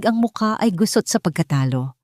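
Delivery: usual speaking voice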